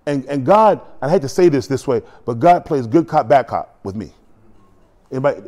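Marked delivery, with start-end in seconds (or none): none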